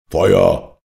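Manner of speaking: loud, brief order; shouted command. A narrator's reading. Captain orders his men to shoot, shouted command. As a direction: call the order out with force. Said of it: "rief der Kapitän".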